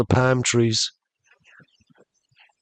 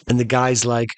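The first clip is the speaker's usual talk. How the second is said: plain reading voice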